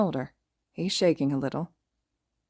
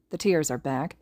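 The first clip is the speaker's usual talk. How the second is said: ordinary delivery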